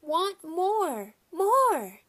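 put-on high, loud toddler wail; toddler crying. A narrator's reading. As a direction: demand it like a crying little kid. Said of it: "Fudge started to cry".

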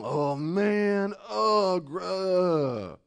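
mock grumbling. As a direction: groan like a moaning complainer. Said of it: "you don't ever go around".

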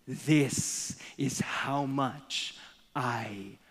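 each word stretched out, fading to near nothing; very slow and hushed at the end; solemn, slow. Acted. Jesus with arms stretched on the cross, solemn and fading.

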